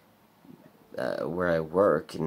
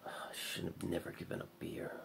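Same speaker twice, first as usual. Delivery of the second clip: much softer, breathy after a sigh; sighing mutter; 14 dB quieter than usual. Real, not acted. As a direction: let it out on a tired sigh. Sighs over giving up beer.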